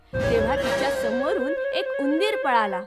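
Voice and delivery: ordinary delivery, nothing marked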